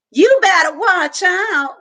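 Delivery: put-on boy hollering a warning to his friend; hollered warning. A narrator's reading. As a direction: yell the warning after her. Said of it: "Dunlap hollered".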